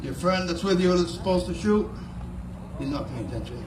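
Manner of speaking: ordinary talk, nothing marked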